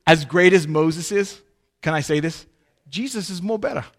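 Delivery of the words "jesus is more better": put-on Italian accent. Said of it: "do my best Italian accent".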